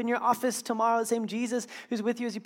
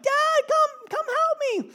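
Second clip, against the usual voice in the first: high falsetto cry for help; comically high, loud plea; mock kid's yell; 4 dB louder, slower than usual. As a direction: call out in a squeaky little-boy voice. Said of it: "I just yell, I'm like".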